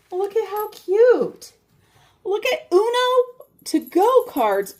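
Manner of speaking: high, slow, drawn-out delight; delighted cooing. Real, not acted. Opening a package from mother's friend, delighted. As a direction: coo over a cute little present.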